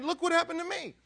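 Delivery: acted thrill, high and loud; excited, thrilled. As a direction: show off what happened, voice soaring. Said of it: "but he's excited. He's thrilled".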